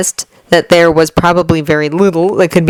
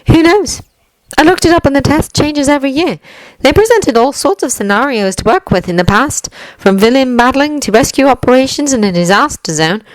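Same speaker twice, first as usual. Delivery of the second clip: put-on higher, composed young woman's voice; poised young woman; 9 semitones higher than usual. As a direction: lay it out calmly as her. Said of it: "she says after a couple seconds of silence".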